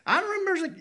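ordinary delivery

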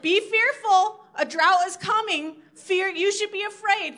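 high, emphatic scaremongering; mock doom warning. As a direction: warn them like a mock alarmist. Parodying an alarmist warning.

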